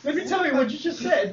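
high, smooth pitch voice; hammed-up salesman patter; sleazy salesman. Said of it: "he puts on a salesman voice".

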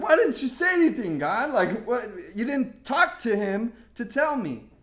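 loud, high-pitched mock protest; hammed-up indignant pleading; exasperated complaint. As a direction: whine loudly at God in disbelief. Acted complaint to God.

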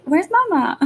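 put-on high toddler voice asking for mama; high, plaintive child's question; little girl asking. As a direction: play a small child looking for her mom. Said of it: "Is she gonna ask for me?".

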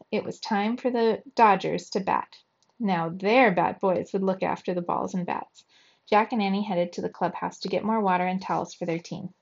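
plain reading voice

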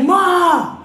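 high, loud annoyed yell; son's yell. Retold or imitated. Son yelling at his mom through the Alexa, loud. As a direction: shout back at mom. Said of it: "And he be like".

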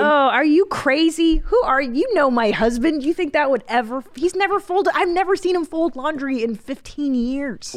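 high, loud disbelief; are you kidding me; incredulous. Real, not acted. Incredulous at the idea.

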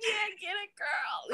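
high, softened playful cheer; small squealed cheer. Retold or imitated. Photographer hyping a couple, high hushed cheer.

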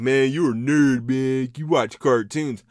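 mocking someone who calls him a nerd; mocking critic. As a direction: sneer it like a critic teasing a nerd. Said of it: "I know people are like".

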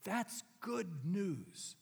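very slow, drawn-out good news; slow sincere. Acted. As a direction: savour every word. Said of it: "Can you feel the power".

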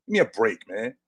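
ordinary talk, nothing marked

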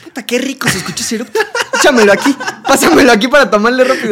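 loud laughing banter; high, loud laughing joke; laughing tease. Real, not acted. Teasing about friend's burp. (burla entre risas fuertes)

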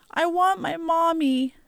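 very high, plaintive little voice; sobbing child. A narrator's reading. Little girl crying for her mother, tearful. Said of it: "Najoni sobbed".

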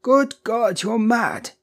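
high, loud, horrified cry; aghast. A narrator's reading. Husband horrified at his wife's wish, aghast. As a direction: exclaim in shock, pitch shooting up. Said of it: "he cried aghast".

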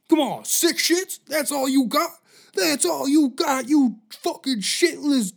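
high shouted drill sergeant rant. Acted. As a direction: berate the private, loud and high. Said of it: "drill sergeant".

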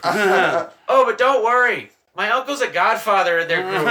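loud, high-pitched comic reassurance; hammed-up reassurance. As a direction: play the friend's punchline through amusement. Acts out friend's reassuring message.

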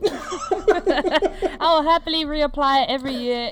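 laughing through the words, slow; giggling as she promises; slow laugh-filled promise. Real, not acted. Laughing agreement between friends.

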